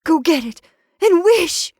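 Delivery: high, loud, urgent command; excited, frantic urging; quivering excitement. A narrator's reading. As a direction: cry it with building excitement. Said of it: "quivering with excitement".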